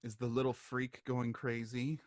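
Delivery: understated, low-key contempt; unimpressed lady. Acted. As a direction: ask it quietly, with cool disdain. Lady opens door, asks coolly.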